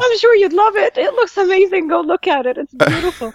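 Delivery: bursting with delight; excited. Real, not acted. Gushing about Legoland with laughter.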